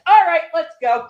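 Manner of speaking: high, loud acted order; impatient command. April orders the kid to the airlock, impatient command. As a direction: call it out, fed up and decisive. Acts April losing patience, taking action.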